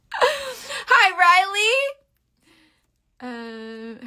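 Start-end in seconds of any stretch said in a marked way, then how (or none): 0.1-2.0 high, bright laughing hello; laughing greeting. Real, not acted. Greeting Riley who just came in, laughing and high.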